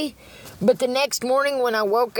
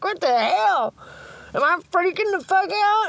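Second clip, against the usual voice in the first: freaked out; 8 semitones higher than usual. Retold or imitated. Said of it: "It's like".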